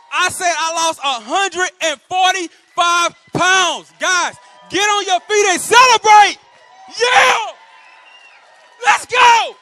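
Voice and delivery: high, loud victory yell; triumphant rallying shout. Real, not acted. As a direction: whip the whole room into celebration. Rallying crowd after weight-loss triumph.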